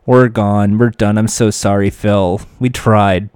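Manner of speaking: low, weary sorry to the host; mock defeated racer, worn out and low; a hint of tired regret; exhausted apology. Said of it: "they're exhausted".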